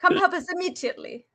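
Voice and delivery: high, fast frantic plea; hammed-up desperate caller; mock frantic plea. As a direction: beg for help in a hurry.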